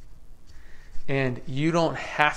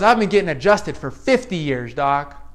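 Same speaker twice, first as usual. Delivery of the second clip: retold painter; 7 dB louder than usual. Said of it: "He goes".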